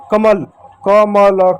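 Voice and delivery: कमल stretched into क-म-ल, each with its vowel; syllable by syllable. Shown as a demonstration. Teacher demonstrating reading letters toward a word, slow and segmented. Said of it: "एक एक अगर आप अक्षर को".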